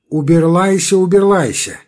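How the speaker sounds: уберлайся with l in place of r. A narrator's reading. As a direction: say уберлайся with a burred r. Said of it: "своим картавым голосом".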